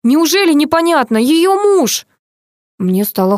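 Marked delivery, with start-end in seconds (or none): 0.0-2.1 high, raised outburst; exasperated shout. A narrator's reading. Doctor tells narrator it is the husband, exasperated. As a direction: cry out in impatience.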